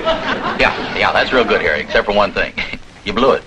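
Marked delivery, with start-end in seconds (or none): 0.0-1.0 laughing. Acted. Laughs at Harry's mistake.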